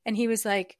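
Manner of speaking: normal talking voice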